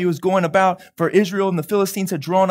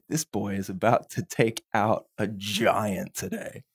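much lower, softer, slow knowing voice; a deep, quiet promise from above; God speaking; 12 semitones lower, 10 dB quieter than usual. Retold or imitated. Preacher voicing God about David, low and slow. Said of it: "but the Lord is saying".

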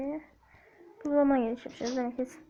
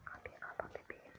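soft whisper; 26 dB quieter than usual. Acted. Whispered aside.

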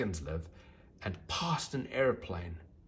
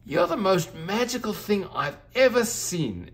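much higher and louder with wonder; a girl gushing over the unicorn; delighted little girl; excited exclamation; 11 semitones higher, 6 dB louder than usual. Acted. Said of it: "exclaimed the little girl".

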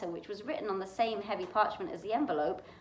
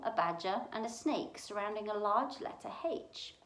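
ordinary delivery

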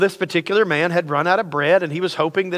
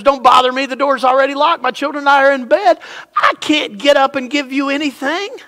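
irritated refusal; 6 semitones higher, 4 dB louder than usual. Acted.

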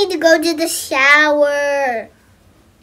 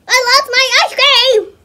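mock wail; 9 semitones higher, 7 dB louder than usual. Kid dismayed at lost ice cream.

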